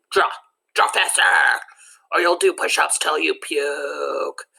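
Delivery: barking drill-sergeant coach; gruff coach voice. Retold or imitated. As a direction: bark orders like a tough gym coach. Imagined coach barking orders.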